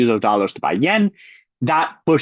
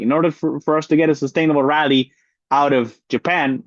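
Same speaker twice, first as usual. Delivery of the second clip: ordinary talk, nothing marked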